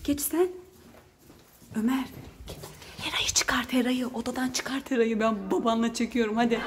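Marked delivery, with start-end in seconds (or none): 1.8-6.6 urgent hushed whisper; whispering while hiding. Real, not acted. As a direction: whisper the secret order. Secret instruction to son.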